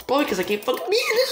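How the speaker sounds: high, hurried whine breaking into a laugh; exasperated wail. Real, not acted. Gamer admitting they can't beat it, exasperated wail. Despairing at losing the game.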